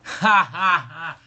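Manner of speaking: slow, booming belly laugh; real loud laughter. Real, not acted. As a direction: crack up at the punchline. Laughing at own joke.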